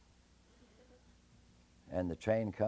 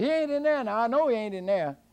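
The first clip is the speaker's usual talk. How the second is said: high, loud bluffing shout; high shouted threat; hollering; 10 semitones higher, 11 dB louder, faster than usual. Acted. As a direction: yell to fool the giant. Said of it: "and say".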